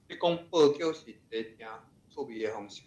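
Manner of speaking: ordinary talk, nothing marked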